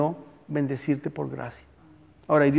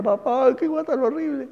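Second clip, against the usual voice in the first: higher, a hint of a crying boy; crying child; 9 semitones higher than usual. Pastor imitating a weeping child to his father. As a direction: mildly voice the sobbing son. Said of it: "el niño lloraba y decía".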